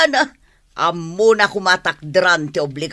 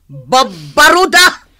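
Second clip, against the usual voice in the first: slow, louder, bitter reproach; mother berating her daughter, slow and loud; scolding outburst; 3 semitones higher, 6 dB louder, slower than usual. Acted. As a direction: spit the word out at her daughter, drawn-out.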